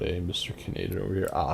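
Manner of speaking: normal talking voice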